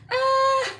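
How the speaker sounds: high, light squeaky "Ah"; a teasing falsetto gasp; mock squeal. Playful retort to Bill.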